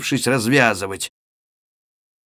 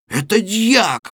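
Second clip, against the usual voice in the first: higher, slow amazed exclamation; astonished; 8 semitones higher, slower than usual. A narrator's reading. Chub recognizing the deacon, slow astonished exclamation. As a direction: draw it out in disbelief. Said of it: "произнёс изумившийся более всех Чуб".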